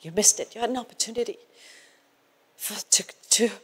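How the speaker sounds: soft, sorrowful near-murmur; hushed gentle rebuke. Retold or imitated. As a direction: quietly voice a tender reproof with long pauses. Voices Holy Spirit's gentle rebuke.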